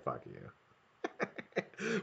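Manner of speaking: imagining his partner in her car, whispering; mock whispered curse. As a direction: breathe it out like she's ignoring him. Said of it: "she's just like".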